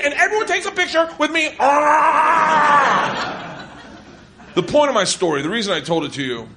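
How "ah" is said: short laughing yelp, higher; laughing shout. Real, not acted. Comic cheering himself at the punchline, laughing shout. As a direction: let out a cackling whoop.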